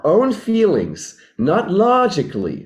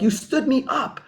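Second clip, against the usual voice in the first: upset partner; 9 semitones higher than usual. Acted. Partner who waited on Tuesday complains, mock-hurt and high. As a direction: play the stood-up date, half-hammed complaint. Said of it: "you're so pissed off at me. You're like".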